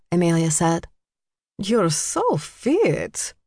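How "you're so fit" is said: high, slow admiring gush; awed socialite compliment; marveling. A narrator's reading. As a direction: stretch it out in swooping wonder. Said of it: "Magda marveled".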